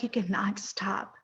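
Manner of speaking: soft unvoiced whisper, a hushed retold message. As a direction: breathe the words with no voice, like a secret.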